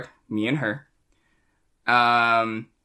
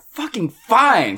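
drawn-out, raised-pitch exasperation; grudging surrender; 7 semitones higher, much slower than usual. Retold or imitated. Giving in to the ex's ride request, exasperated. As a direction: give in slowly, stretching it out, fed up.

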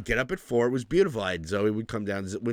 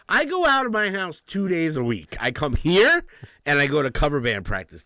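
higher and louder, fed-up protest; exasperated rant; 7 semitones higher, 6 dB louder than usual. Retold or imitated. Ranting to Manal about never going out, higher and louder. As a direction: vent it at full stretch. Retold complaint to wife.

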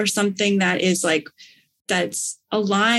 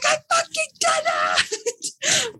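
so excited; slower than usual. Retold or imitated. Retelling her airport reaction to a friend, triumphant excited shriek. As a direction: scream it like you just won. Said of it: "so excited".

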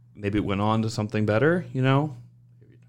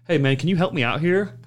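asking for help; 6 semitones higher, 3 dB louder than usual. Retold or imitated.

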